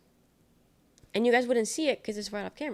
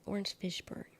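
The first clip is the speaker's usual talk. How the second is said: whisper; 8 dB quieter than usual. Real, not acted. Muttered name aside.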